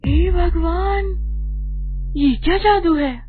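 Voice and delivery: high, drawn-out gasp of amazement; astonished. Acted. Wife seeing the pot pour out money, astonished. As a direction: cry out in wonder, stretching the first words.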